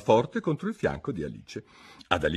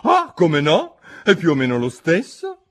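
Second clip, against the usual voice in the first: higher, louder, pompous duchess; 9 semitones higher, 8 dB louder than usual. A narrator's reading. Duchess lectures Alice, pompous and higher. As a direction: preach a moral she cannot recall.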